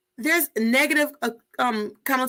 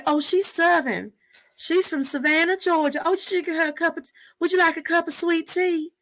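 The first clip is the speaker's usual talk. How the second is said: sweet Southern hostess voice, lightly done; Southern accent; 6 semitones higher than usual. Retold or imitated. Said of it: "That's my Southern way".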